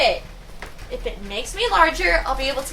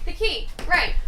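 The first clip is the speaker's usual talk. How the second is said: ordinary talk, nothing marked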